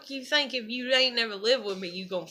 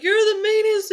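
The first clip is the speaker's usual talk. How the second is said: husband's complaint; 8 semitones higher, 5 dB louder than usual. Retold or imitated. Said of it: "And he's like".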